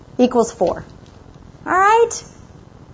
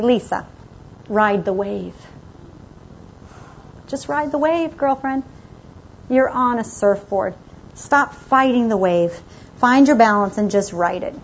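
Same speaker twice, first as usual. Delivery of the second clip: soothing husband; 5 semitones lower, 6 dB quieter than usual. Retold or imitated. Husband calming her on phone.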